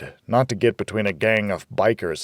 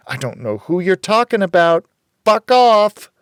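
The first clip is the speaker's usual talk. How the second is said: higher, much louder yell; angry shout; 10 semitones higher, 7 dB louder than usual. A narrator's reading. Rita yelling at the stranger, angry and loud. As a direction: drive the stranger away.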